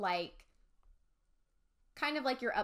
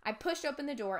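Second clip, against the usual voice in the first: plain reading voice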